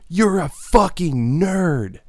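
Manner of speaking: high, loud and slow, hammed-up; slow, loud comic jab, voice pushed up high; mock yelled insult. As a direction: shout it at them like a punchline. Comic rant insult to fans.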